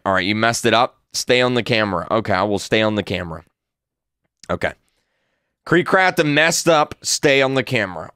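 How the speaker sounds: ordinary delivery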